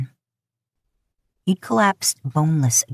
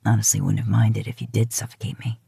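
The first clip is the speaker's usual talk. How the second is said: low breathy whisper; 7 semitones lower than usual. A narrator's reading. Lover confesses in bed, low whisper.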